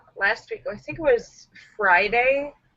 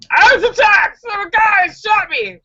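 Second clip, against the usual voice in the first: high, loud mock-hysterical cry; hammed-up victim shouting to the cops; panicked false victim; 11 semitones higher, 5 dB louder than usual. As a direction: yell a phony alibi in panic. Acts man lying to cops.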